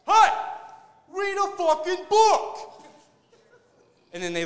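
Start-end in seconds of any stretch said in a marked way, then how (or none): 0.1-2.8 loud, high mobster shout, drawn out; hammed-up gangster bellowing an order. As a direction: yell it like a pushy mobster Siri. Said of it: "like a mobster".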